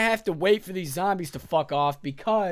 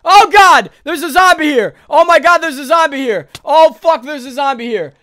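shrieking in real panic at a zombie; panicked screaming; 9 semitones higher, 15 dB louder than usual. Real, not acted. Gamer alone in the house screaming at a zombie, terrified.

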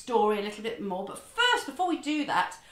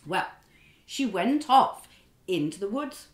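ordinary talk, nothing marked

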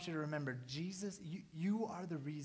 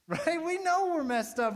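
laughing; 11 semitones higher, 10 dB louder than usual. Real, not acted. Laughing self-deprecation.

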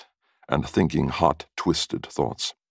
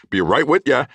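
higher, louder call to a customer; raised, high-pitched shout-back; 9 semitones higher, 5 dB louder than usual. A narrator's reading. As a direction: call out to someone in the next room.

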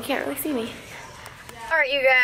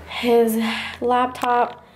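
usual speaking voice

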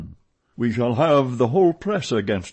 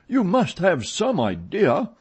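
high-pitched insistence; mildly exasperated appeal; cried out; 10 semitones higher, 4 dB louder than usual. A narrator's reading. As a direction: press him with a raised voice. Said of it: "cried Richard".

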